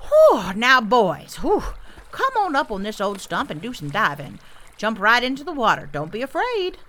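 lightly voiced grandpa beaver with loud puffs; puff, then urge the youngsters on; grandpa beaver, encouraging. Grandpa beaver coaxing his grandsons into the water, encouraging.

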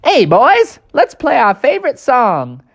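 high, loud cartoon rallying call; rally the band in a chirpy high voice; high-voiced cricket calling out; cricket bandleader. Cricket bandleader calls his band.

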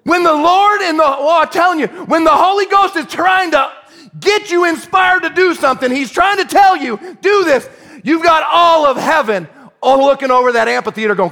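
high, loud pulpit delivery; impassioned preaching. Real, not acted. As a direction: preach it with building fervour. Preaches at full force.

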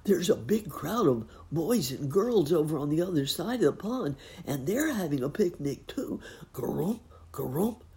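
put-on high croaky frog boy.

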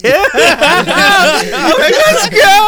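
high, loud laughing "let's go"; hyped cheer. Retold or imitated. Hyped being spun in barber chair.

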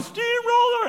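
very high-pitched mock yell; high falsetto mock holler; retold yell. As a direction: imitate a crowd of kids yelling, squeaky high. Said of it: "then everybody's yelling".

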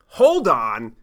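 sharp, loud interruption; firm loud. Retold or imitated. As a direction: cut her off hard. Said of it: "And I go".

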